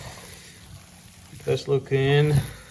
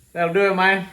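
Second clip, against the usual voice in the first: loose, higher Cajun accent imitation; louder Cajun accent catchphrase; Stale Cracker Cajun accent; 4 semitones higher, 5 dB louder than usual. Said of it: "I can't talk in Cajun accent".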